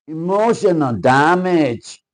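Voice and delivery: high, loud, slow drawn-out yell; meme shout. Acted. Reading the card to viewers, loud slow meme yell. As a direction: scream it like the internet meme.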